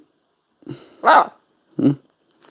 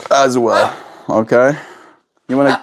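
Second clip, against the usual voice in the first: ordinary talk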